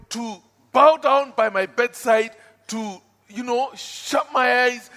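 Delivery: loud, high, bitter threats to God; young man threatening God in prayer; defiant angry prayer. Retold or imitated. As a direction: shout your grudge at God.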